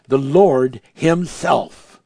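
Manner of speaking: drawn-out, weighty reading; slow emphatic. Real, not acted. Preacher reading the verse to the church, slow. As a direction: lean on each word slowly.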